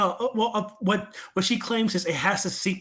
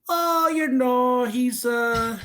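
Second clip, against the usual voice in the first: drawn-out, higher evasive reply; 7 semitones higher, slower than usual. Retold or imitated. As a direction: stall with a long, high "oh". Acting grandma dodging the question.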